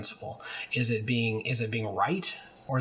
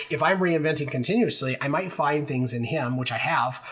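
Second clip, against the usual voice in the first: ordinary talk, nothing marked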